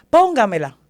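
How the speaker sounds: a mother demanding to be put on the line; insist, raising your voice; retold demand.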